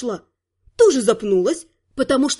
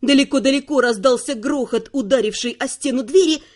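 ordinary delivery

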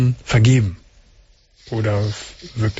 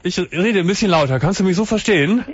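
pitched much higher to carry; speaking up; 6 semitones higher than usual. Real, not acted. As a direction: project up for the caller. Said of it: "Du musst schon ein bisschen lauter reden".

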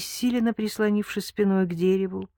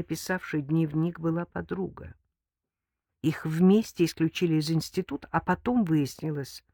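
usual speaking voice

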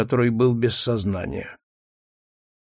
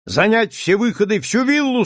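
shouted command; 7 semitones higher than usual. A narrator's reading. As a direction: command the agents to seal exits. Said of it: "скомандовал он".

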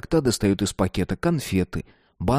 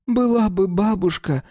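high, slow, muffled from another room; through a door; 11 semitones higher than usual. A narrator's reading. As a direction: voice heard from behind a door. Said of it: "донеслось из-за двери".

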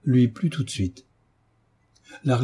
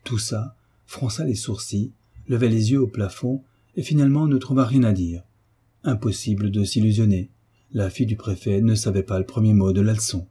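ordinary delivery, nothing marked

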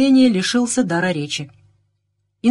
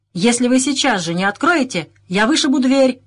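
higher, louder threat, moderately shouted; narrated yell; 6 semitones higher, 3 dB louder than usual. A narrator's reading. Inspector threatening to break down the door, moderate yell. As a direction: call it out through a closed door. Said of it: "завопил инспектор".

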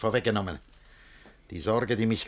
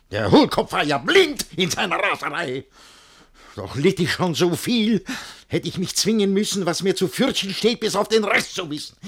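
higher, louder, hurried fretting; agitated rant; 9 semitones higher, 6 dB louder than usual. Acted. Guardian fuming at the blind fool, higher and louder. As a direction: boil over with worry and anger.

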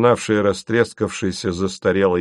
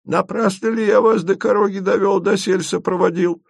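put-on a lilting, higher old-Russian storyteller; higher folk guide voice; 10 semitones higher than usual. A narrator's reading. Asen tempts the travellers with tales of the maiden. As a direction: tease like a village storyteller. Asen's voice.

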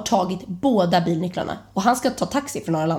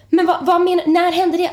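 higher, louder frantic asking; panicky question; 9 semitones higher, 5 dB louder than usual. Retold or imitated. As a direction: demand answers in a panic. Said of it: "jag får ju panik".

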